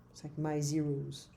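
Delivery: ordinary talk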